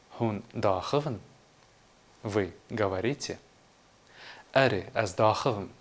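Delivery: ordinary delivery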